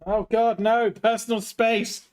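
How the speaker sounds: hammed-up plea for personal space; mock panic. Mock panic at being attacked.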